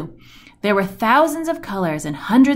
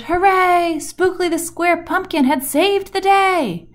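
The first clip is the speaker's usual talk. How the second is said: higher, happy cheer of a crowd; cheering shout; 8 semitones higher than usual. Acted. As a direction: cheer it out for the hero. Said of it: "All the pumpkins shouted".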